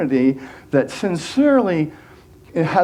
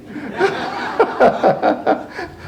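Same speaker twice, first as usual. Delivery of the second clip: breathy laugh; 9 semitones higher than usual. Real, not acted. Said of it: "I'm channeling Bugs Bunny".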